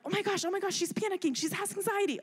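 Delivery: a hammed-up flustered worrier; mock panic. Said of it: "freaking out".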